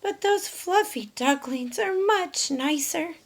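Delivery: high hen voice, a touch wistful; a little hen admiring the ducklings; Dora the hen. Acted. Dora's thoughts.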